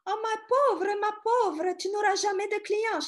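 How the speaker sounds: put-on high, loud Russian-accented French. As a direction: pity her in French with a theatrical Russian accent. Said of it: "She said with a Russian accent".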